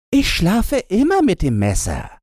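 put-on cocky girl boasting; lightly voiced robber girl. A narrator's reading. Robber girl tells Gretchen she sleeps with the knife, lightly voiced.

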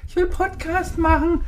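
put-on high, slow childish whine; mother mocking her daughter's whiny request; imitating the little daughter. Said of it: "die gesagt hat".

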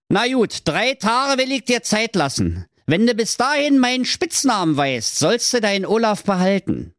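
very high squeaky imp, a little calmer; grudging evil doctor. Acted. Doctor giving Trulla three days, high smug squeak. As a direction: grant the deal like a smug cartoon villain.

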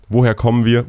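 ordinary talk

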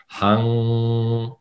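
Hang, mit Anlauf auf A und NG in die Nase; Hang: A kurz, dann NG nasal halten. Shown as a demonstration. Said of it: "nimm ein bisschen Anlauf mit dem A". (Hang vorne in die Nase gesprochen)

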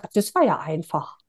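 relieved thought. Retold or imitated. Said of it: "ich hab mir so gedacht".

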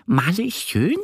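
high-pitched duck character, asking; little duck's question. A narrator's reading. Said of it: "fragt die kleine Ente".

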